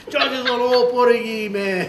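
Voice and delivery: laughing quote. Retold or imitated. Friend excusing George, laughing.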